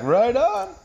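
high, lifted Canadian pick-up voice; joke-telling imitation of a pleased Canadian; Canadian bloke. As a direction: pitch it up for an upbeat reply. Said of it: "And he's like".

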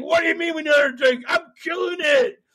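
high, fast, outraged yell; indignant Trump shout. Retold or imitated. Donnie refusing the producer's retake, fast indignant shout. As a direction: bristle at being asked for another take. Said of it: "He's like".

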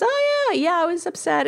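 put-on high, light little-boy voice for her young son; imitating little son. Mother imitates her young son's offhand reply, high childlike voice. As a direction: play the five-year-old brushing it off. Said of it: "He goes".

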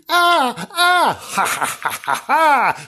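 high mock screams breaking into evil cackle; loud hammed-up shrieks and cackling; ghostly screams, demonic laughter. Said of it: "her ghostly screams and the demonic laughter".

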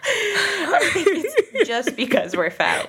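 high, drawn-out words through laughter; real helpless laughing. Real, not acted. Host joking with her friend about breathing, helplessly laughing. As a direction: squeeze it out while cracking up. Cracking up over breathing joke.

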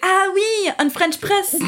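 high French "ah oui" of sudden recognition; French shopkeeper. Retold or imitated. Guy at the counter catching on, imitated high French accent. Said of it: "And he was like".

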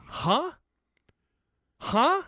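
rising puzzled huh, twice; confused huh. Retold or imitated. Retelling his confusion to the co-host, puzzled. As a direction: ask again, baffled and rising. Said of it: "I said twice".

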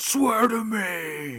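loud, high, drawn-out Batman roar; Batman impression. Batman interrogating a corrupt cop, slow roaring demand. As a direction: roar it slowly as the interrogating hero. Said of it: "it's when he gets the".

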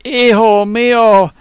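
high, loud sob on the words, slowed; sobbing cry. A narrator's reading. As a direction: cry it out with a sob that shakes the room. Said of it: "And then with a sob".

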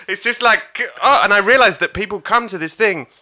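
normal talking voice

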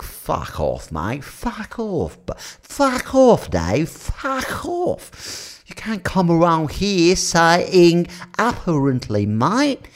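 high, drawn-out British pub regular; British pub accent. Acted. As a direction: tell them off in caricatured pub British. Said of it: "Even the pub ... around the corner is like".

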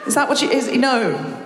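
usual speaking voice

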